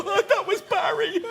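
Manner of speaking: high, drawn-out comic dismay; shocked horse. Acted. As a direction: gasp it like a horse spotting a lost friend. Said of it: "worn by a barrister and go".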